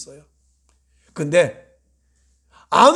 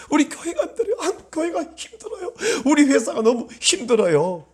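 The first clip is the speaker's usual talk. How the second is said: pleading lament; 8 semitones higher than usual. Retold or imitated. Pastor voicing a believer crying out hardships to God. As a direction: cry out each burden.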